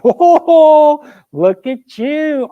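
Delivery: put-on high, loud, drawn-out boy's holler; jeering little boy. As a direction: hoot up at the soldier like a small boy. Muddy little boy hails Grant.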